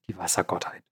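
whispering the word; whispered. Real, not acted. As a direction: breathe it as a hushed aside. Whispered aside in explanation.

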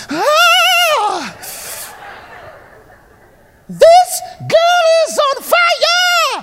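high, loud, slow mock shriek; hammed-up shout. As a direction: belt it like a startled singer. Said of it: "it was like".

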